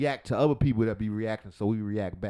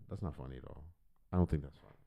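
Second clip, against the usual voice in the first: normal talking voice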